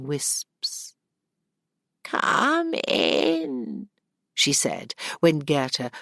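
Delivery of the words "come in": put-on high, thin old crone voice; a cackling old innkeeper woman welcoming her in. Old woman greets Gerda at inn.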